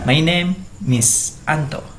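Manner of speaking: slow, name joined to is as Miss; slowed demo of linking name into is; slow linked demo. Shown as a demonstration. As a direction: stretch it out, My name Miss Anto. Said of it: "hilang, tinggal name".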